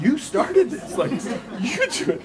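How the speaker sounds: high, laughing push-back; laughing protest. Retold or imitated. Preacher retelling the Skid Row night, laughing and high. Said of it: "I was like".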